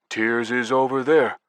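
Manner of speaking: a hint of someone else's intonation; copying another's speech. A narrator's reading. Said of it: "I copied Banger's manner of speech and intonation".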